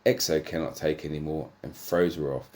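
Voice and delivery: ordinary talk, nothing marked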